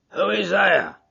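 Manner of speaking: a hint of a gruff doorkeeper calling out; gruff porter. Porter calling from behind the door, mildly gruff. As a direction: call through the door, lightly gruff. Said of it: "cried a gruff voice from within".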